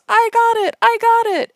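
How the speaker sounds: high, loud shrieking joy; mock ecstatic squeal; excited scream. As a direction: scream it like you just won the jackpot. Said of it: "Emily screams".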